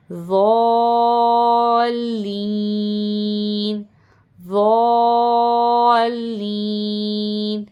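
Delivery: ضالین with a very long held aa; long madd. Shown as a demonstration. Teacher demonstrating the madd, ضالین greatly drawn out. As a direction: hold the vowel of ضالین, very slow and sustained. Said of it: "اس کے اوپر مد ہے".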